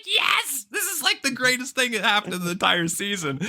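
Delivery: very high-pitched, thrilled outburst; ecstatic fan cheer. Retold or imitated. Fan reliving the villain reveal, ecstatic high-pitched cheering. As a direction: squeal it like an overjoyed fan.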